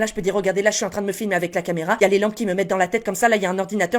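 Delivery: fast, improvised running description; rapid patter. Shown as a demonstration. Creator describing the room as practice, rapid thin-voiced patter. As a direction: rattle it off without stopping. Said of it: "j'essaye de parler rapidement comme ça".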